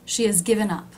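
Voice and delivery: "has" heard as "is", H dropped; reduced has. Shown as a demonstration. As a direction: say "She has given up" with no H. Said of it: "I have dropped the H".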